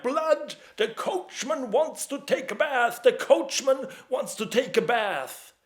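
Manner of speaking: slow, roaring mock-ghoulish shouting; drunken yelling. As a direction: bellow it like drunk boys taunting a friend. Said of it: "yelling all the time".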